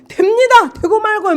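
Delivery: high, loud cry of assent; shouting yes. Retold or imitated. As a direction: call it out eagerly. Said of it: "막 주님한테 막 소리쳤어요". (주님께 소리쳐 응답)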